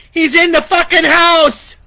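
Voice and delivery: high, loud frantic yell; terrified yell. Acted. Vicky yells to Lori that the killer is inside. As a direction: scream the warning. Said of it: "Vicky yelled".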